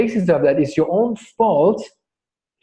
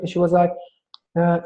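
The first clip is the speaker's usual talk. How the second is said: ordinary delivery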